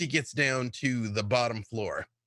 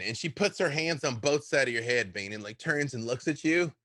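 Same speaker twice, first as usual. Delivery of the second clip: usual speaking voice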